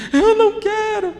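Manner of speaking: very high, drawn-out wailing refusal; a small boy sobbing at the school gate; desperate crying child. Retold or imitated. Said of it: "a chorar desesperado".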